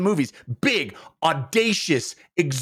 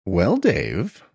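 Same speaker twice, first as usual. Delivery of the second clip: mock-formal; 10 semitones lower than usual. Mock-formal answer to the host.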